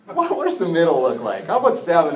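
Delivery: high, loud bargaining voice; haggling disciples. Acted. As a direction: haggle up high like at a market stall. Disciples haggling over forgiveness.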